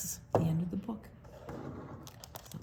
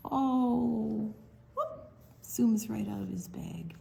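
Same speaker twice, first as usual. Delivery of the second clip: playful whisper; 3 semitones higher, slower than usual. Real, not acted. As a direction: whisper the escape with glee. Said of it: "whisper to kids".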